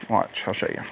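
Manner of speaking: whispered under the breath; soft whispered aside. Real, not acted. Aside while working.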